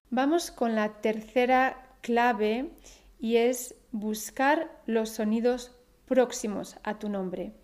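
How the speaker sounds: usual speaking voice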